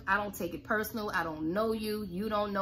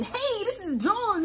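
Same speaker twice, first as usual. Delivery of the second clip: higher, louder, slower overeager hello; overexcited salesy; 11 semitones higher, 4 dB louder, slower than usual. Shown as a demonstration. Caller greeting a prospect in an overexcited salesy voice. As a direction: pump the hello way up. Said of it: "Don't be all excited".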